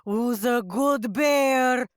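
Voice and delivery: loud, drawn-out pet talk; cooing to bear. Acted. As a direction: gush loudly at a good animal. Player sweet-talks the bear like a pet.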